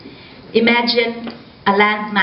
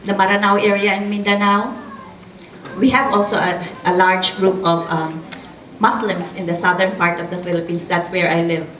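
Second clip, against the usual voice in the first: ordinary delivery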